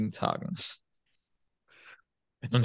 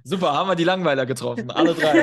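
loud, higher, talking through laughter; amused mock praise, laughing; laughing tease; 7 semitones higher, 8 dB louder than usual. Real, not acted. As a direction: joke through a real laugh. Jokes that all three are bores.

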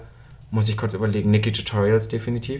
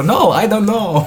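high, slow mock Spanish-accented English; mimicking a celebrity's thick Spanish accent, slow and high; Sofia Vergara impression; 9 semitones higher, 4 dB louder, slower than usual. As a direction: drag it out high in a heavy put-on Spanish accent. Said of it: "sie war so".

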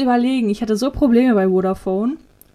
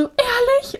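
much higher, thrilled "Ehrlich?"; happy disbelief; 13 semitones higher than usual. Retold or imitated. Hearing the phone finally worked, thrilled high squeal. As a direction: squeal it with sudden hope. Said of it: "Und war so richtig happy".

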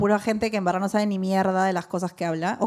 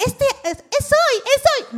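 much higher, giddy squeal; mock giddy squeal of hope; squeaky excited hope; 13 semitones higher than usual. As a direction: squeal it like a hopeful girl. Her hopeful self, squeaky.

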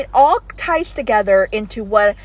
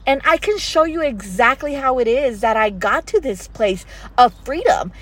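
ordinary talk, nothing marked

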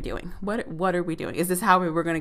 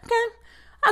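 exasperated high okay. Real, not acted. Exasperated check to viewers.

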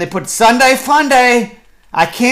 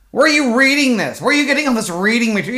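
ordinary delivery

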